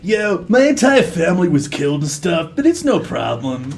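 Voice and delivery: chilled-out surfer dude, trailing down; surfer bro. Acted. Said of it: "He's like a surfer bro".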